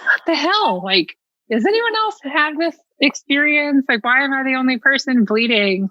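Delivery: put-on alarmed young girl wondering if she's alone; panicked inner voice. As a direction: replay the frightened eleven-year-old. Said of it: "I'm thinking".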